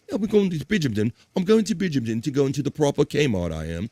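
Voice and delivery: mock-posh, swallowing Bridgehampton to "Bridjumton"; snooty posh accent. Host playing a snob going shopping, snooty posh accent. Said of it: "in the snooty way".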